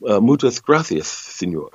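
hammed-up lispy Castilian Spanish accent; mock a Barcelona Spaniard, lisping "sí" and "gracias". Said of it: "it's kinda lispy".

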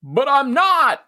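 high, drawn-out hammed-up yell; mock shouting. Podcaster parodies a housemate's confessional, mock shouting. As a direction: bellow the confession like a contestant. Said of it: "thank you for shouting".

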